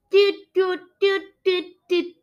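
high, even horn calls; imitated horn toots. A narrator's reading. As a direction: toot like mermen blowing horns. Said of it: "The mermen blew their horns".

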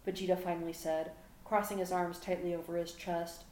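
plain reading voice

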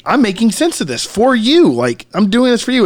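a touch of condescending explainer; lightly mock a patient teacher voice; imitating the YouTuber. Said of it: "the way he's talking".

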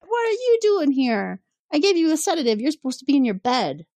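high, startled doctor scolding her patient; shocked Crusher. Retold or imitated. Doctor finds her sedated captain up and about, shocked and scolding. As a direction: jump up high in alarm, then settle into a scold. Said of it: "is like".

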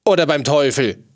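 loud, threatening bellow; roar. A narrator's reading. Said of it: "brüllte der Unhold".

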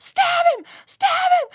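very high, loud shout; shrieking stab him from the fray; battle shout. Retold or imitated. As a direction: scream it at a friend mid-fight. Shouting in mock fight.

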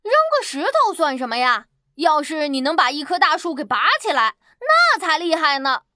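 lightly voiced boy, pretending not to care; mock indifference. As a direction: shrug it off to provoke the bear. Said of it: "小男孩假装不在意的样子". (小男孩假装不在意，撇嘴说)

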